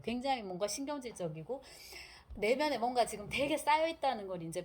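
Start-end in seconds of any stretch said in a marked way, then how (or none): none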